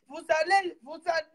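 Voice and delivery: ordinary talk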